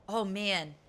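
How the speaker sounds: ordinary talk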